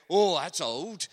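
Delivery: lightly voiced daft removal man; a hint of a goofy comic workman; Chuckle Brothers voice. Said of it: "they were like the Chuckle Brothers".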